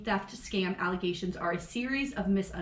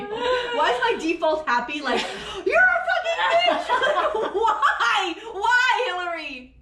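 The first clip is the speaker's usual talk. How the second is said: high, loud wail through laughter; hammed-up laughing exasperation; 10 semitones higher, 5 dB louder, slower than usual. Host berating herself for cursing a friend, mock despair. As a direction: wail at yourself, cracking up.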